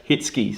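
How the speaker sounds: Hitsky said slowly and clearly as HITS-kee, stress on the first syllable; correct name pronunciation. Shown as a demonstration. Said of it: "So, it's H I T Z K E".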